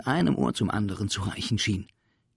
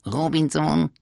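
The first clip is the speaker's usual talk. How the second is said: cheeky creature voice; 8 semitones higher, 8 dB louder than usual. Acted. Cheeky Sams answers teacher.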